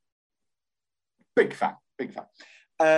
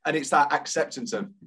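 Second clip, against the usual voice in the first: ordinary delivery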